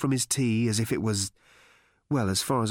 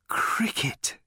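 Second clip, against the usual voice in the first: whispered with pleasure; pleased whisper; 7 semitones higher than usual. A narrator's reading. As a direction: breathe it, delighted. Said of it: "he added with pleasure".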